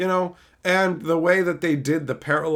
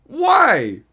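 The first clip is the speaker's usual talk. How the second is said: rising, louder bewildered what and why; baffled; 4 semitones higher, 4 dB louder, much slower than usual. Retold or imitated. Streamer reacting to being called a rage baiter, growing bewilderment. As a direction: escalate from confused to exasperated. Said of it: "I was just going".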